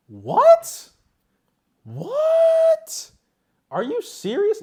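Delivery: high, loud incredulous squeal; shocked disbelief. Real, not acted. Incredulous outburst at claim.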